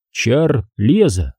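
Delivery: Чар-леза said slowly as two separate words; Чарльз as two separate words, slow; split into two words. Shown as a demonstration. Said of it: "Имя Чарльз он произнёс так, будто это были два разных слова".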